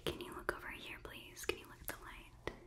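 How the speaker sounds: normal talking voice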